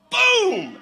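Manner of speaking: big, high, booming "Boom!"; loud imitated collision; crash noise. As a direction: burst out with the sound of impact.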